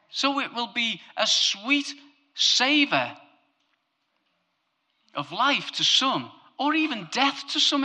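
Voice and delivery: high, loud preaching build; full-force sermon voice; impassioned preaching. Acted. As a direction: preach it out with fire.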